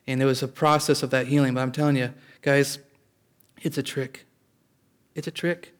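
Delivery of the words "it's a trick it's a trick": ordinary delivery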